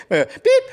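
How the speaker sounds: high beep through a laugh; laughing voicemail beep. Acted. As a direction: squeak the beep while laughing. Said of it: "end of voicemail".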